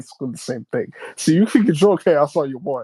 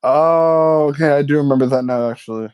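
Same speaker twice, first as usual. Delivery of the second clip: normal talking voice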